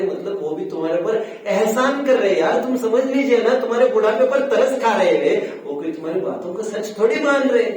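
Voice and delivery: loud, high-pitched reproof; forceful scolding. Real, not acted. Preacher telling elders listeners only pity them, loud and scolding. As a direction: drive the point home with rising exasperation.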